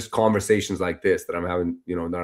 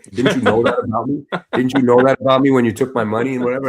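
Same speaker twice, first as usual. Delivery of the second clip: rising, louder, pleading indignation; exasperated protest; 5 semitones higher, 6 dB louder than usual. Retold or imitated. Telling the event team it's his money, exasperated. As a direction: push back hard, it's not my fault.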